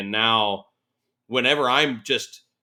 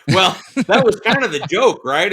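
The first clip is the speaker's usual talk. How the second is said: laughing; 9 semitones higher, 9 dB louder than usual. Real, not acted. Laughing at name joke.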